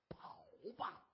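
hushed, voiceless urging; furtive whisper. Acted. Urging the man to run for it, whispering. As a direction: whisper it like a secret escape plan.

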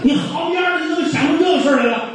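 the judge shouting down the butcher's excuses; angry judge. Acted. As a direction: cut him off and roar at him.

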